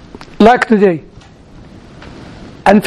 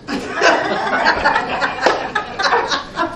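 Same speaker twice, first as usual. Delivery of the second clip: soft real laugh; 7 semitones higher, 9 dB quieter than usual. Real, not acted. Laughs after asking for fries.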